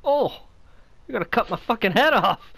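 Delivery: loud alarmed shout; panicked yell. Real, not acted. Panicked at nearly getting hit.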